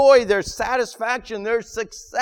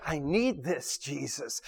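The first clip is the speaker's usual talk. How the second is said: hushed prayer; 7 semitones lower, 10 dB quieter than usual. Acted. Prayer voiced inside sermon.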